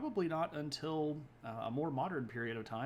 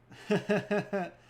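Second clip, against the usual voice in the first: high, loud chuckle; quick loud run of laughter; sudden amused laugh; 8 semitones higher, 8 dB louder than usual. Real, not acted. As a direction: burst into a short laugh. Amused by a chat comment.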